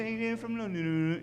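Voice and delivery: high, soft garbled British; cartoon British babble; unintelligible London accent. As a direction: mumble London talk past understanding. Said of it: "I had no idea what they were saying".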